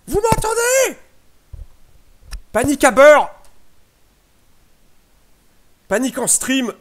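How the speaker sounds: shrill, shouted mock panic; panicking gamer. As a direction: yell like the stream is dying. Stream freezes, mock panic.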